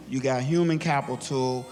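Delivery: usual speaking voice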